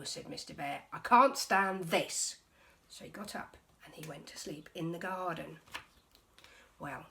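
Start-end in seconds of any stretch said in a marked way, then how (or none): 0.9-2.4 loud, slow exasperated groan; loud grumpy complaint. Acted. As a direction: drag it out, fed up. Mister Bear exasperated.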